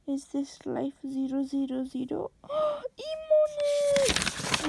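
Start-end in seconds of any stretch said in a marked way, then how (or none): 2.4-4.7 very high, long drawn-out shriek; gasp, then squeal it loud and stretched; excited squeal. Real, not acted. Vlogger spotting a drawing, loud excited squeal.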